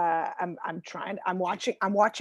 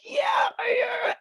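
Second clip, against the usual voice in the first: strained fake yes; 13 semitones higher, slower than usual. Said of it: "I'm like".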